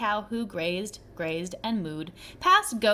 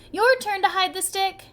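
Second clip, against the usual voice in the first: calling out; 8 semitones higher, 6 dB louder than usual. A narrator's reading. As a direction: call across the playground, teasing. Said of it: "they'd call".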